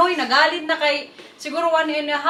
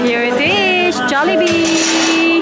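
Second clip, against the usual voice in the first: loud, high, drawn-out reveal; excited announcement; 3 semitones higher, 5 dB louder, much slower than usual. Real, not acted. Vlogger shows off Jollibee to viewers, excited and drawn out. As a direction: sing out the name, stretching it long.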